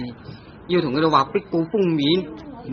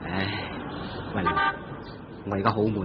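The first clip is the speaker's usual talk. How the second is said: sigh, then softer and lower; weary and low; 5 semitones lower, 9 dB quieter than usual. Acted. Husband telling cousin he feels low, weary and soft. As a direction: sound fed up and deflated.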